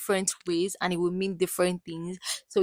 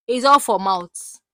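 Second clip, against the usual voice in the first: ordinary talk, nothing marked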